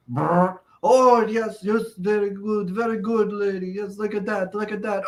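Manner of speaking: high, lightly voiced approving wrestler; a hint of the Iron Sheik cheering; mock Iron Sheik. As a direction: lightly ham up the old wrestler's delight. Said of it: "you just hear him go".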